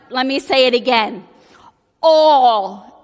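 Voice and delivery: All said alone, loud and drawn out after a pause; stress on All. Retold or imitated. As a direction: land the word All with full weight after a beat. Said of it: "and he's like".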